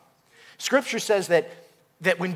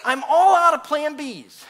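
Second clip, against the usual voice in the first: raised, loud plea to God; desperate surrender; 6 semitones higher, 5 dB louder than usual. Acted. Believer with no options left to God, desperate surrender. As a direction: cry out to God, slowing on the admission. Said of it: "it's not until this place that we say".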